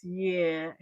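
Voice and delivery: low, soft, drawn-back reply; imitated cool rapper. Rapper answering breathless fans, imitated low-key cool. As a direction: play him unbothered, quiet and low. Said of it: "he was like this".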